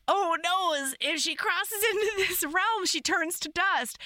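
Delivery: high-pitched retold warning; mock alarm. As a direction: ham up the worried realization. Repeats hero's alarm in retelling.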